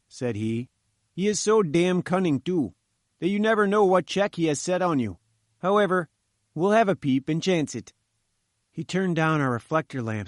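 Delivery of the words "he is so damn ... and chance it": higher, wary engineer's voice; chief engineer. A narrator's reading. Engineer warning visitors about the cunning professor, higher character voice. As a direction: voice the engineer higher, grumbling warily.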